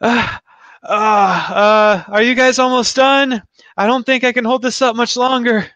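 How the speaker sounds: high, strained plea from a struggling caster; straining under effort. Acted. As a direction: call out as if barely holding on. Caster struggling to hold force field.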